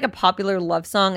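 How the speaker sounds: usual speaking voice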